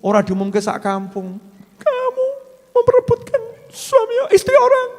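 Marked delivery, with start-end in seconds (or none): 1.8-4.9 very high, wailing accusation; shrieking accusing woman. Pretend. Wife publicly accusing the other woman, shrill wailing cry. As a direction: shriek it like a jealous wife crying.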